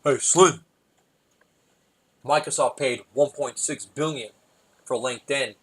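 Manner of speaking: slowed-down rapper impersonation; lightly voiced hip-hop mogul talking business; measured, weighty delivery. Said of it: "This is [name]".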